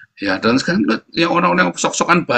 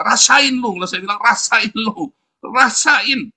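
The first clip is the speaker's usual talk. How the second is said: gloating taunt; 8 semitones higher, slower than usual. Real, not acted. Gloating at enemies.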